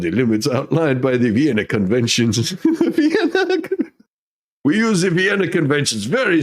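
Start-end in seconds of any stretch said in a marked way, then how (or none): 2.5-3.9 laughing. Real, not acted. Cracks up at the word.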